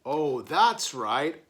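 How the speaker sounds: ordinary delivery, nothing marked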